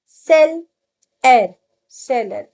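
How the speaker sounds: "सेल" then "एर" said loud and apart, then "सेलर" joined; split into syllables. Shown as a demonstration. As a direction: break "सेलर" into "सेल" and "एर", then join them. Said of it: "split करके सिखाइए". ("सेलर" को "सेल" और "एर" में तोड़कर बोलना)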